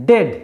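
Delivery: ডেড with one short "e" vowel. Shown as a demonstration. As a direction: say ডেড to rhyme with "bed". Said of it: "ই এ এর উচ্চারণ কিন্তু এ হলো".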